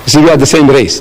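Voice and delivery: ordinary talk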